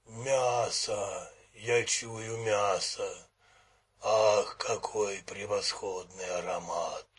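low, slow, greedy monster voice; hungry monster. A narrator's reading. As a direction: savor the smell of meat. Nouda sniffs meat.

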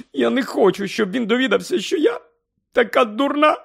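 high, tearful and shaky; crying. Acted. Mother crying about being foolish, high. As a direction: sob out the shame.

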